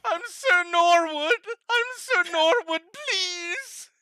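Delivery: high, loud pleading wail; sobbing Sir Norwood. Acted. Captive begs his tormentors for release, sobbing loudly. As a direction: beg through hammed-up tears. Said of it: "As he sobs".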